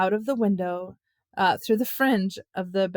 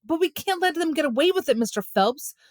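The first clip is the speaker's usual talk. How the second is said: high-pitched protesting call; 8 semitones higher, 3 dB louder than usual. A narrator's reading. Joe calls to Alan's father from afar, high protesting call. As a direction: call plaintively from the next room. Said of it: "called Joe from the living room".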